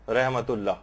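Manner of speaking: a quiet, low रहमतुल्लाह. Shown as a demonstration. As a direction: murmur रहमतुल्लाह as a second example. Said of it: "हा की आवाज जो एक मोटी सी एक आवाज".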